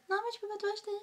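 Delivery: high, fast self-scolding voice; scolding inner voice. Retold or imitated. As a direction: rattle it off like a nagging conscience. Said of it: "en mode".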